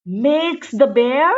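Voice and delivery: usual speaking voice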